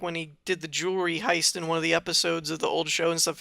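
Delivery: ordinary talk, nothing marked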